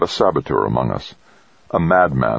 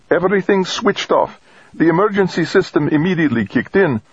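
much higher-pitched, urgent character voice; grim cosmonaut woman; 12 semitones higher than usual. Acted. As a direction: report the emergency in a raised pitch. Voices Stacy reporting power failure.

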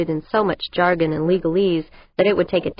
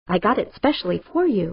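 plain reading voice

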